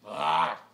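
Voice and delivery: loud, low frustrated growl; short burst of wordless exasperation; angry growl. Real, not acted. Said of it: "I just got angry".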